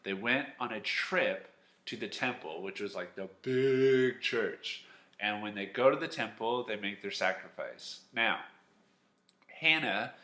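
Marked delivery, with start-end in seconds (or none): 3.1-4.8 "big" stretched out loud and higher; stress on "big". Real, not acted. Teacher telling children about the temple, heavy stress on "big". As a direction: draw out "big" slowly, with force.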